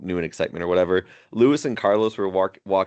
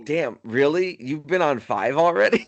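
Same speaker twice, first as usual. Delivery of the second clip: higher-pitched, questioning mock astonishment; retold surprise; 7 semitones higher than usual. Lewis reacting to Carlos's fifth team, mock surprised. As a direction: play a taken-aback listener, voice raised. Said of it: "you just see Lewis reacting like".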